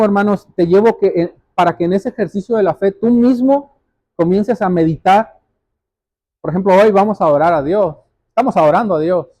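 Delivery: ordinary delivery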